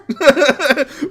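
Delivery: a real cackle breaking through; high laugh. Real, not acted. Laughing at own joke.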